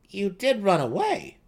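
high, loud gnome accusation; teasing gnome. A narrator's reading. Seldig teases the dark elf, high and loud. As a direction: press the point with a grin.

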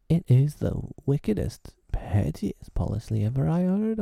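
high, gushing admiration; admiring cry. A narrator's reading. Smee admiring Hook's plan, crying out. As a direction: marvel at the wickedness. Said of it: "he cried".

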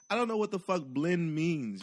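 usual speaking voice

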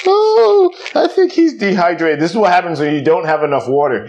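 high, loud fake alarm; mock alarm. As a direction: overplay the concern. Mock concern about loopy bird.

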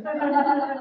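quick high breathy chuckle; a light laugh at himself; high soft laugh. Real, not acted. Preacher laughing at his old view of Baptists.

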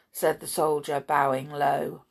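ordinary delivery, nothing marked

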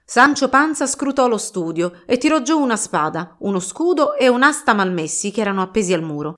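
ordinary delivery, nothing marked

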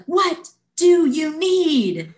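slow, high shouted question; volunteer yelling the question again; mock yell. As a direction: bark it at a frozen athlete. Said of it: "finally she yells at me".

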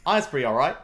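amused, put-on Australian accent; mock-Australian accent, played for laughs. Shown as a demonstration. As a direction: keep up a rough Australian accent. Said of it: "That's my Australian accent".

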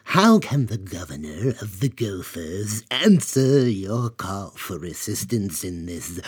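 a slow, lisping gopher creature voice; a courtly giant gopher, lisping; low lisp. Acted. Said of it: "began in a low lisp".

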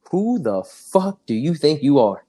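a hint of a stern coach snapping, slowed down; lightly voiced coach demanding an answer; the coach mildly confronting the freshman in front of the team; imitating an angry coach. Strength coach calling him out.

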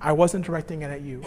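put-on soft, quiet apology to a child; father softly explaining to his son; gentle apology. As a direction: tell your son gently it wasn't him. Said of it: "I could just go to him and say".

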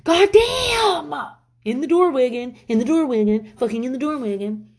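grumbling at the family blocking doorways; mock angry yell. Mom griping at relatives crowding the doorway, mock angry yell. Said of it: "or be like".

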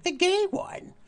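very high, chirpy grandma answer; drag grandma voice. Retold or imitated. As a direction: beam it out proudly. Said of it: "they're like".

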